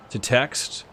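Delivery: normal talking voice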